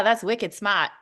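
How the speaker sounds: "wicked smart" in broad Boston; imitated Boston accent. Quoting a best friend from Boston, Boston accent. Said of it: "You nailed the accent".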